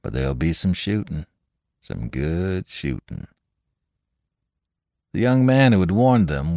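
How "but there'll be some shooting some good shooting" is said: grimly. Acted. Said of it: "answered one man grimly".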